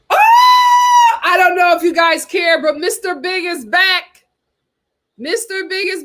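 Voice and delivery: very high, loud thrilled shout; bursting with the news; real excited yell. Real, not acted. Announcing Mister Big news.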